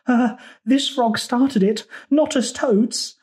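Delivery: high, slow, squirming toad voice; frightened toad. A narrator's reading. Toad pleading with the crocodile, high frightened whine. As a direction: squeak out the blame nervously. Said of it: "Toad squirmed in fright".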